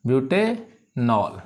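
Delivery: slow butanol, pausing between ब्यूटे and नॉल; syllable by syllable. Shown as a demonstration. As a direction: sound out butanol for pupils to follow. Teacher repeats it in parts.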